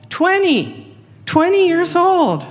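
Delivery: high-pitched amazement, drawing out the age; amazed. Real, not acted. Telling listeners how young he is, amazed. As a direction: marvel at it, voice jumping up.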